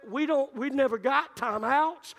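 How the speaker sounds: normal talking voice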